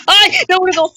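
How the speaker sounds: sudden yelp as you fall; high startled scream; screaming while falling. Real, not acted. Falling off the tower.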